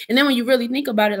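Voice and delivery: ordinary talk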